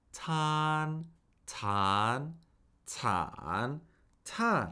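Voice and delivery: cycle "tan" through flat, up, down-up, down; "tan" four times, each with the next Mandarin tone; four-tone drill. Shown as a demonstration. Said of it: "cycle this syllable through the four".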